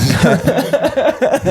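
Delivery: squealing burst of laughter; high laugh. Real, not acted. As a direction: laugh high at your own joke. Laughs after apology joke.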